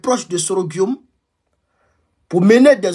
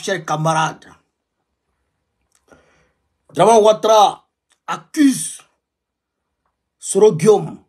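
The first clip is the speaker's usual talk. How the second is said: fiery revolutionary speech. Acted. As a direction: hammer out the alarm to comrades. Fiery address to African comrades.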